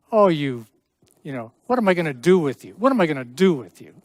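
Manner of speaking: fast, loud, mock-despairing scold; fond exasperation. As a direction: tease them like a hopeless dear one. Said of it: "they're kind of saying".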